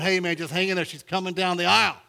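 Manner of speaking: high, loud mock-tearful reassurance; lightly acted choked-up cheering on; tearful minister encouraging. Minister propping up the groom at the altar, mildly tearful encouragement. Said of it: "The minister's crying, too, and he's going".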